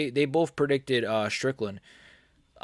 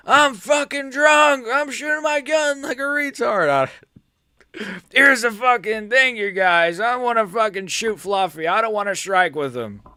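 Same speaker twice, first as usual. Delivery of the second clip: put-on much higher, loud, slurring drunken rant; drunk Sean Strickland; 9 semitones higher, 3 dB louder than usual. Drunk fighter ranting to the guys about shooting his opponent, high and loud. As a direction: ham up a drunk fighter waving a gun. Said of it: "impression of drunk Sean Strickland shooting his gun".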